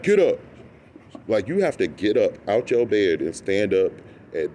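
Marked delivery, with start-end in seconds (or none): 0.0-0.4 sharp, higher guard command; barked order. Retold or imitated. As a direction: bark it like a jail guard.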